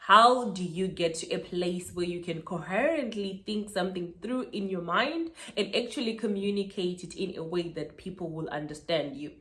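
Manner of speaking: usual speaking voice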